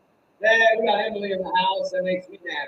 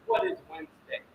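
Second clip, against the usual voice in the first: soft, teasing build-up to the answer; teasing quiet question; 7 semitones higher, 7 dB quieter than usual. Acted. Host teases the viewers, soft and hushed. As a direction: hold it back, almost confiding. Hyping up the livestream audience.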